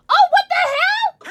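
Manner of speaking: high, loud shriek of surprise; real shocked squeal; startled shout. Real, not acted. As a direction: yell it, caught off guard. Startled by a raid alert.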